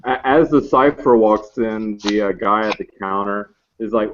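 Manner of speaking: ordinary talk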